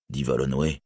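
ordinary delivery, nothing marked

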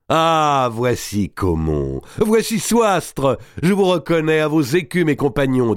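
high, exclaimed greetings to comrades; joyful hailing. A narrator's reading. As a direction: call out each name with delight. Robert salue joyeusement ses partisans. (salutations exaltées, lancées à voix haute)

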